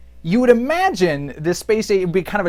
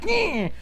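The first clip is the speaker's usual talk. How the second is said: high nasal-free zap cry; a cartoon magic blast noise; mock zap noise; 13 semitones higher, 5 dB quieter than usual. Skeletor firing magic with a mock zap cry.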